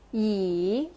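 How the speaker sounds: "Yi" dipping low, then rising; third tone. Shown as a demonstration. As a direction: draw out "Yi", down and back up. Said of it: "bring it down and then bring it back up".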